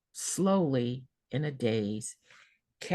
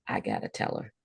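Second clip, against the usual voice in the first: breathy whisper, much lower, to herself; whispered thought; 5 dB quieter than usual. Retold or imitated. Nurse thinking to herself in a whisper, realizing.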